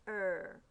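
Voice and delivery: "er" held as one R-coloured vowel; isolated ER sound. Shown as a demonstration. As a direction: sound out "er" on its own. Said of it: "we hear er".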